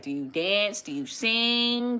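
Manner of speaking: usual speaking voice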